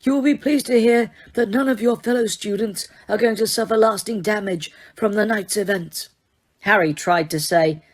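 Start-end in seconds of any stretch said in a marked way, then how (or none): 0.0-6.1 put-on higher, gentle old headmaster voice; a calm, lightly voiced old wizard; Dumbledore, calm. A narrator's reading. Headmaster reassuring Harry, calm old wizard voice.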